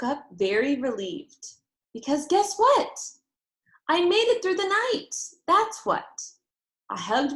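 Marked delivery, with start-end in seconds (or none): 1.9-6.3 much higher, louder, bursting with relief; a kid proudly announcing she made it; excited little girl. Acted. Junie B. telling the listener she survived the night, much higher and louder.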